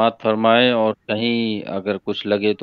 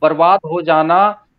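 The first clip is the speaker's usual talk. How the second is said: emphatic unsung ghazal recital; spoken verse recitation; 7 semitones higher, 4 dB louder, slower than usual. Acted. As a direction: declaim the couplet with rising, lingering phrase ends. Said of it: "मैं तहत में ही पढ़ता हूँ".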